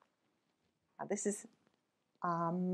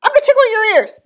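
high, loud teasing; loud playful threat; 13 semitones higher, 15 dB louder, faster than usual. Acted. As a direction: tease it loudly.

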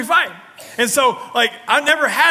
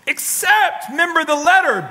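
higher, louder exclamation; preaching shout; 12 semitones higher, 6 dB louder than usual. Acted. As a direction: call out to the church. Preaching at full force.

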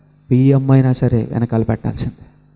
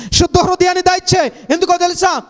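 high, loud climactic preaching; preaching at full force; 3 dB louder than usual. Acted. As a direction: build the sermon to a ringing peak. (ఉద్రేకంతో బోధించే స్వరం)

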